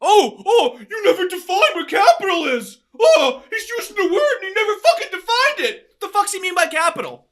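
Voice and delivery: high, loud mocking protest; mock outrage.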